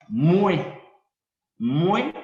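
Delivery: "mue" said loud like mweh; Creole pronunciation. Shown as a demonstration. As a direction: say "mue" as one syllable, mweh. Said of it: "para decir yo". ("mue" pronunciado fuerte, como mwe)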